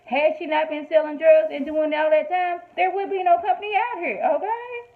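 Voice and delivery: high, loud, indignant defense; rising outrage, near tears; heated rant. Real, not acted. Vlogger ranting at Lucius in Cookie's defense, high and loud.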